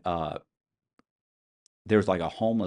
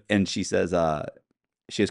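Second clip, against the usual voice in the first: usual speaking voice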